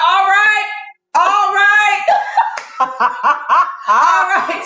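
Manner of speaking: high, drawn-out encouragement; high-pitched cheering. Retold or imitated. Doctor cheering as the mother pushes, high-pitched.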